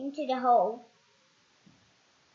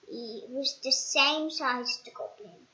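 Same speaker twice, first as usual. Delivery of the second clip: ordinary delivery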